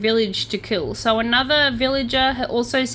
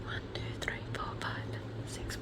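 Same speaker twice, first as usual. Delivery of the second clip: barely audible whisper, evenly counted; hushed self-calming count; 19 dB quieter than usual. Real, not acted. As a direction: count to six under your breath. Counting to self mid-report.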